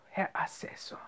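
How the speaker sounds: whispered, breath let out; very soft, almost voiceless address; relieved, under the breath. A narrator's reading. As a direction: exhale the title softly in relief. Relieved Ochs catches his breath.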